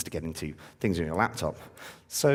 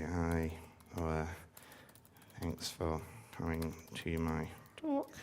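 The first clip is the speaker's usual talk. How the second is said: slow, quiet, halting words while typing; 2 semitones lower, 9 dB quieter, much slower than usual. Real, not acted. Speaker typing a thank-you message live on stage, slow quiet typing voice. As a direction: murmur each phrase as you type it. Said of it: "that's my typing voice".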